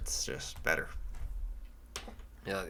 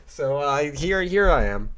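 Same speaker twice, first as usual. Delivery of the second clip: ordinary talk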